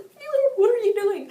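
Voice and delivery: high, loud, squeaky cringe; cringing disbelief. Retold or imitated. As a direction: whine it at the character in horror. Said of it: "I was just cringing".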